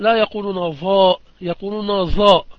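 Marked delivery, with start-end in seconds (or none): 2.0-2.5 ظاء said as a plain za like common speech; imitating common people saying ظاء as za; colloquial z for ظ. Shown as a demonstration. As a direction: say ظاء the way laymen do, as za. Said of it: "كي يقولون العوام".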